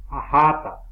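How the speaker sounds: Ajata with a loud middle JA; stress before last syllable. Shown as a demonstration. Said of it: "la vocal que suena más fuerte". (Ajata con la JA más fuerte)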